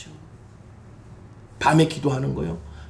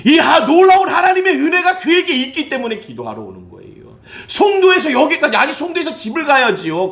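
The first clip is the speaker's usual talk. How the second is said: fiery preaching; 9 semitones higher, 4 dB louder than usual. Acted. Pastor praising a devoted church member, fiery preaching. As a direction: build to a shout.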